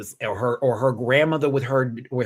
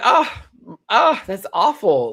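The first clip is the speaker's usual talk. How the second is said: slow, higher and louder dismay; 8 semitones higher, 7 dB louder, slower than usual. Retold or imitated. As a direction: react in disbelief. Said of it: "I was like".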